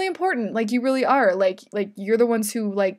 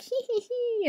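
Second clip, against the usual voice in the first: gleeful giggle; 14 semitones higher, 5 dB quieter, slower than usual. Pretend. Gleeful sorting noise.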